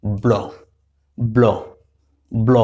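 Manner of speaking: ব্ল said as one fused bl cluster; blended bl sound. Shown as a demonstration. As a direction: drill ব্ল three times, crisp. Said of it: "সংযুক্ত উচ্চারণ".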